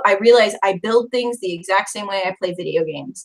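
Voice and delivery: ordinary delivery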